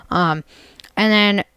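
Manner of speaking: ordinary talk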